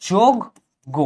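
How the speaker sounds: "যজ্ঞ" as jog, pause, go; closed o, split. Shown as a demonstration. Teacher modelling the rule, "যজ্ঞ" split jog - go. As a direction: say "যজ্ঞ" in two syllables with rounded o. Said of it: "আমার উচ্চারণটা হবে".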